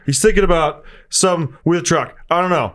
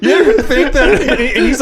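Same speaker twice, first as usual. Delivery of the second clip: mock laughing through the line, voice pitched higher and louder; imitating laughing fit; 8 semitones higher, 5 dB louder than usual. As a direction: copy a comic who can't get the joke out for laughing. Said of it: "like, dying".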